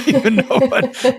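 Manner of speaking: words squeezed out through a laugh; high, giggling aside. Real, not acted. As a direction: laugh while you say it. Laughs mid-sentence at own remark.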